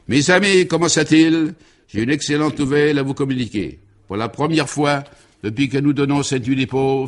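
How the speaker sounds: orate to the whole ballroom; pompous speech. Acted. Baron's speech from a chair.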